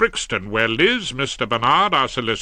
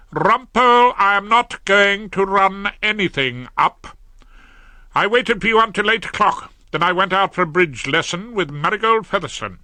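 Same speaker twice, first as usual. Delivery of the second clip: sharp, raised scolding voice; a wife voiced higher and louder, scolding; indignant wife; 8 semitones higher, 4 dB louder than usual. A narrator's reading. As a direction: put him firmly in his place. Hilda scolding Rumpole.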